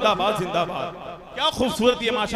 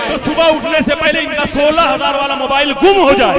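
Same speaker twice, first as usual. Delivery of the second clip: joking curse; 5 semitones higher, 11 dB louder than usual. Acted. Host jokingly curses the audience.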